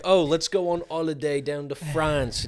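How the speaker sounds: breezy Brit planning a holiday; mock British holidaymaker. Host imitating Brits planning a holiday in a British accent. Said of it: "you guys would be like".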